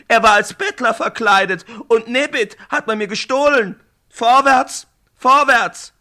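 loud, high raging orders; furious commander. Acted. Brafane furiously drives his warriors after the devil's horse. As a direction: roar them forward, building to the last cry.

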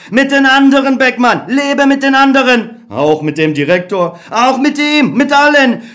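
optimistic Other. Acted. The Other urging Beckmann to trust people. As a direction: coax him to believe.

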